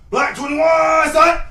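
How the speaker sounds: high, loud, slow drawn-out snap count; switched-up cadence shout. Pretend. Player switching up his cadence, high drawn-out shout. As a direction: holler the number long, then punch the last word. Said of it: "Even when I switch it up".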